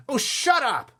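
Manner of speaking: high, loud rebuke; angry snap. A narrator's reading. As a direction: shut her down with a yell. Boy snaps at girl.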